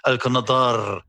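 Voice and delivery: "Al Qanadar" with a strongly rolled final R. Shown as a demonstration. Actor showing the odd word to accent buffs, "Al Qanadar" with rolled R. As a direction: trill the R that ends "Al Qanadar". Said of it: "roll my R's on".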